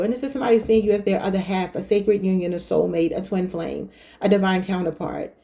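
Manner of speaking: normal talking voice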